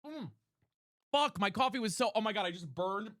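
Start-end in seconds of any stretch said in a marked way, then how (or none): none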